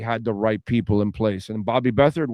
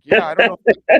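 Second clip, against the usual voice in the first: laughing; 6 semitones higher, 9 dB louder than usual. Retold or imitated. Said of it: "I'm like".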